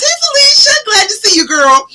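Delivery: high, loud, thrilled hello; excited greeting. Real, not acted. Greeting a viewer joining live.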